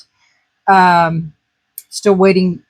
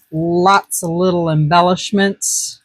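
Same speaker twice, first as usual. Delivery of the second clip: usual speaking voice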